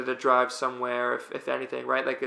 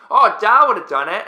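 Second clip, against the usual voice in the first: high, loud put-on Aussie; Australian accent; 7 semitones higher, 10 dB louder than usual. Teammates needling Gabe about a water bottle, Australian accent. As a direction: pipe up in a rough Australian accent. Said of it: "your Australian accent is".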